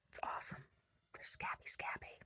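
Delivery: hushed, creepy-delighted whisper. Real, not acted. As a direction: whisper it like a gross secret.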